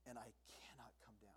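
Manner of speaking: whisper, low and final; whispered resolve. Retold or imitated. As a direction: breathe the last words out. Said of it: "you just have to say".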